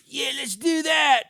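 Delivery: high, loud, stretched-out mock villain voice; George Soros impression. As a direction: shout it like a cartoon schemer calling his buddy. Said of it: "That's my George Soros impression".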